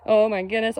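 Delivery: ordinary delivery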